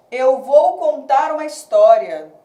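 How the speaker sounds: "Eu vou contar uma história" loud, each beat marked; emphatic scansion up to the last stressed syllable of "história"; syllable-count demonstration. Shown as a demonstration. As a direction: project the verse, stressing the "stó" of "história". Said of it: "só contei como divisão silábica até o stó".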